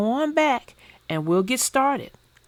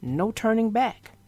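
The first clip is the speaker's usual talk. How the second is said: put-on gentle rhyming read-aloud for kids; storybook reading. A narrator's reading. Vlogger reading a bedtime rhyme to kids, storybook voice. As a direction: read the picture book to young viewers. Reads picture book aloud.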